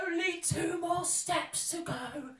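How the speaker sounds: sing-song robber. Acted.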